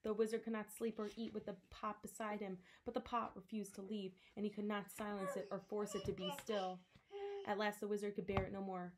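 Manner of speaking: plain reading voice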